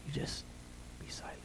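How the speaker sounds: very soft, near-whispered words; hushed. Shown as a demonstration. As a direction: let the words fade towards silence. Models being silent before God.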